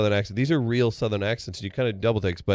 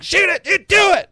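very high loud shout; mock fan shout; angry fan yell; 9 dB louder than usual. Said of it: "it's not just like".

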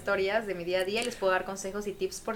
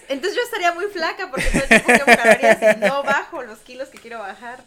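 said through a laugh, louder; laughing while joking; 3 semitones higher, 11 dB louder than usual. Real, not acted. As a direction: joke about herself while laughing. Jokes about burning calories thinking. (riéndose mientras bromea)

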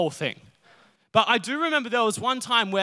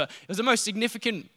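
ordinary delivery

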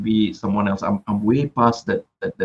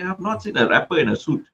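lightly voiced wife, higher pitch; wife's voice; 7 semitones higher than usual. Husband quoting his wife's suit idea, lightly imitating her. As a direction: quote your wife in a slightly raised register. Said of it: "She's like".

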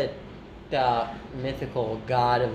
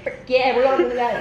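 high, loud gung-ho cheer; hyped-up, raised-pitch imitation; excited quote; 9 semitones higher, 5 dB louder than usual. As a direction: act out a cartoon character raring to go. Acting out cartoon penguin's gleeful resolve.

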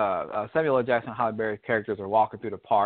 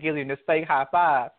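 ordinary talk, nothing marked

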